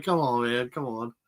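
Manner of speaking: ordinary talk, nothing marked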